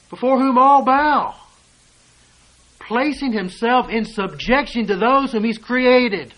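loud, high, ringing pulpit voice; full-force preaching. Acted. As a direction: preach it out hard to the congregation.

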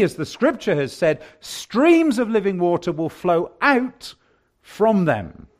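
loud, high revival preaching; full-force preaching. Acted. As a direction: lift the voice and urge the whole congregation.

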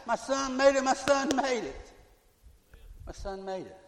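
high, proud mother's voice fading at the end; a mother rejoicing that her son made it; joyful mother at the gate. Retold or imitated. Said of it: "she'll be at that gate saying".

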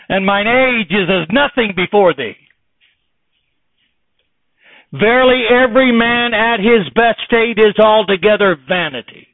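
loud, high, ringing sermon voice; fervent preacher building to a climax; preaching at full force. Real, not acted. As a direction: drive each phrase home from the pulpit.

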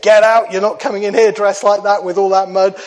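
loud, high, indignant cafe lady; cafe lady shouting. Retold or imitated. Cafe lady chasing muddy men out, loud shout.

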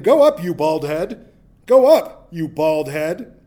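loud schoolyard jeer; mocking taunt. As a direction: taunt the old prophet from the road. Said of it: "mocked him".

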